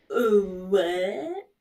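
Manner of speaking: gasp, then rising drawn-out disbelief; mock shock. Retelling a childhood discovery about OJ, mock shock. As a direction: gasp and act out being stunned.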